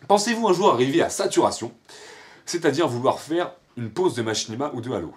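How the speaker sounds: high, loud presenter reading; question read-out. Real, not acted. Vlogger reading a question about saturation, high and loud announcing voice. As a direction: launch the next question high and loud, easing slightly.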